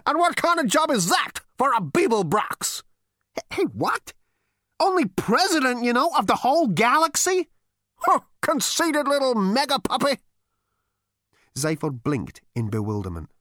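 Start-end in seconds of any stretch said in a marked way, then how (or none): 0.0-10.2 put-on high, scornful old man; ghostly great-grandfather scolding; crotchety old ghost. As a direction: sneer down at the useless great-grandson. Ghost mocking Zaphod.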